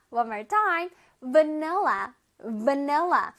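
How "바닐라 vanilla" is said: 바닐라, vanilla as vuh-NEL-la with buzzing v; proper English pronunciation. Shown as a demonstration. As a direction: teeth on lip for the v of vanilla, stress the middle. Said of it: "V이기 때문에 윗니로 아랫입술을".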